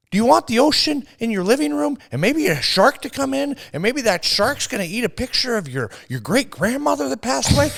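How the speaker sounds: hammed-up shrieking TV host; manic host. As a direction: scare the viewer like a crazed pitchman. Host's absurd shark pitch.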